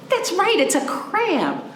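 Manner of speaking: high cheerful praise; excited praise. Real, not acted. Teacher praising the children's guess, high and cheerful.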